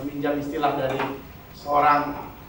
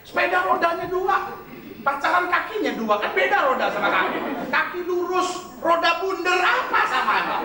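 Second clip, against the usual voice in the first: mocking high scoffing; 11 semitones higher than usual. Mocking the wrong view.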